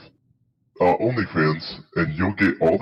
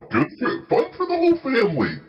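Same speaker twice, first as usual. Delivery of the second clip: squeaky ad voice; 14 semitones higher than usual. Pretend. Mock ad tagline.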